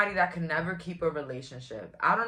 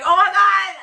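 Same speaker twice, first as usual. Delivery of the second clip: high, loud delighted shriek; excited squeal; 14 semitones higher, 15 dB louder than usual. Real, not acted. Thrilled thinking of Canadian viewers.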